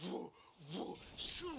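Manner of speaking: cartoon whirling noises; whooshing sound effect. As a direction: voice the whoosh of someone flung away. Imitating spinning and throwing someone.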